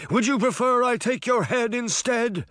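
high, wavering phantom moan; moaning threat. A narrator's reading. Sabrina as the Horseman threatening Crane, moaning. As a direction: moan the threat like a wailing ghost. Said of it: "Sabrina moaned".

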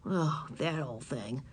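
put-on sulky boy muttering under his breath; low slow mutter of a bored boy. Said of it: "muttered Howie".

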